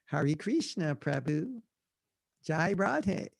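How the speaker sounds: softly mimicked American "Hare Krishna", HAIR-ee KREESH-na; broad American-accented devotee greeting. Shown as a demonstration. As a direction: say the Sanskrit like an American, with an English r. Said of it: "we say everything like Americans".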